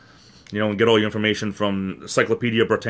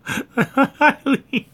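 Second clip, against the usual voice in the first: high-pitched laugh; 4 dB louder than usual. Real, not acted. Laughing at own joke.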